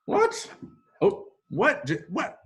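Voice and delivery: hammed-up surprise; mock startled. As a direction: act shocked by the voice from nowhere. Pretending narrator startled him.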